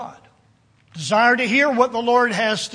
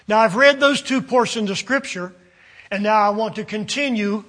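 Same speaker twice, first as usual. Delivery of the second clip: ordinary talk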